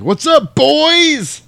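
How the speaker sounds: high, loud drawn-out shout; hammed-up gleeful taunt. Paul surprises the brothers from behind the door, gleeful shout. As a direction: holler it, stretching the last word. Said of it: "He's like".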